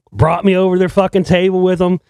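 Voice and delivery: ordinary talk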